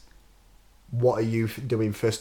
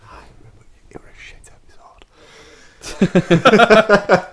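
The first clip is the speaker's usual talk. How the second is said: mock whisper; 10 semitones higher, 8 dB louder than usual. Teasing the special guest, whispered jab. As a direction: whisper the insult, then blurt. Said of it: "teasing whisper then blurt".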